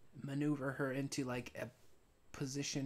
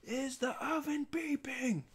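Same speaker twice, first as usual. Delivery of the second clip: much higher, slow, whiny manager's question; fussy manager voice; 14 semitones higher, 4 dB louder, slower than usual. Retold or imitated. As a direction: play the prissy manager, drawing it out high. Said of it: "the managerial guy was like".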